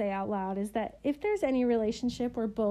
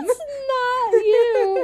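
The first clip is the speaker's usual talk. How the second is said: mock cry; 12 semitones higher, 11 dB louder, much slower than usual. Mock crying quip.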